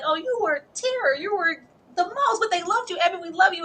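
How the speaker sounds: a hint of a sweet old teacher cooing; mock doting teacher. Teacher praising him at wedding.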